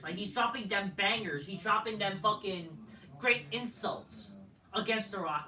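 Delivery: usual speaking voice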